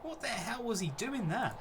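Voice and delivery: berating the keeper in disbelief; exasperated rant. Real, not acted. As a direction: pitch climbs in exasperation. Ranting at the keeper's blunder.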